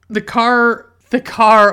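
excited exclaim. Real, not acted. Recalling the car in Uncle Buck, loud excited burst. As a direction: burst out the car, thrilled to remember it.

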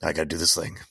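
lightly voiced understated hero, low; Optimus Prime. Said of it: "why he sounds like that".

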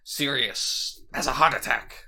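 a hint of supervillain voice. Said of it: "supervillain voice?".

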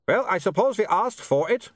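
high-pitched call over a din; shouted. A narrator's reading. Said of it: "he shouted".

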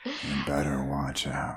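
slow, low and soft warning; ominous tagline. Acted. Echoing a friend's Christmas horror tagline, ominously quiet. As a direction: drop it into a sinister trailer murmur.

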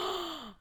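gasp. Real, not acted. Gasp at scary memory.

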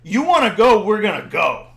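fired-up street bravado; testy. Retold or imitated. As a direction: act out getting riled up and cocky. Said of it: "I'm getting testy now".

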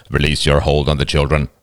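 low, even angel's command; strange deep voice. A narrator's reading. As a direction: instruct them in a deep otherworldly voice. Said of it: "strange, deep voice".

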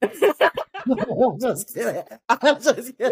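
laughing through the words, high and loud. Real, not acted. Cohost laughing off her teasing question. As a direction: crack up while taking it back.

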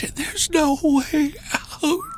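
high, slow, feeble wail; weak gasp. A narrator's reading. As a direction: draw it out faintly and hopelessly. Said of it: "the weak woman gasped".

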